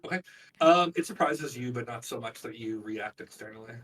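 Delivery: usual speaking voice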